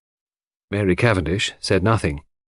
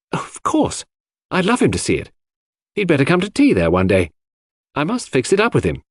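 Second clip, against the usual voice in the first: put-on higher, lively voice for a friendly young woman; fond, amused Cynthia; 6 semitones higher than usual. Cynthia chatting about a dear little man, fond and amused. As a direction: play her chatting with lifted pitch.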